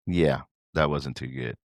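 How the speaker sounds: normal talking voice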